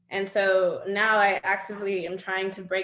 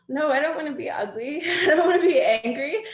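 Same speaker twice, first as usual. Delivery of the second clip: higher, whiny pleading voice breaking into a laugh; mock pleading; 7 semitones higher, 5 dB louder than usual. Guest mimics her younger self to the host, pleading and laughing. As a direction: play your younger self begging not to be ugly, half laughing. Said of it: "I'm like".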